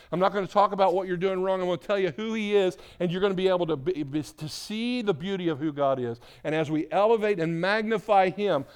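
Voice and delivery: loud, high, fast preacher's delivery; rapid-fire, forceful sermon; fired-up preaching. Real, not acted. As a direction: build urgent momentum and promise it to the congregation.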